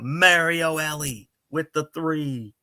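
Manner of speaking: high, drawn-out commentator call; imitated announcer's yell. Recalling an announcer's famous three-point call, imitated yell. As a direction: recreate the big basket call, stretching the words. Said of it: "and he yells out".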